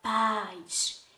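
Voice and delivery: "paz" held as one long syllable. Shown as a demonstration. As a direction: say "paz" drawn out in a single breath of sound. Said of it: "quando nós pronunciamos essa palavra".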